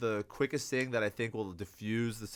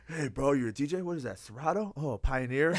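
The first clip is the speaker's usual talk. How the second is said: chatty bro voice; 3 semitones higher than usual. Retold or imitated. Imitating chatty guest at DJ booth.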